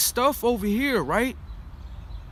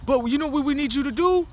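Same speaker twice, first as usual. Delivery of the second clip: mock recruiter; 6 semitones higher than usual. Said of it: "They gonna be like".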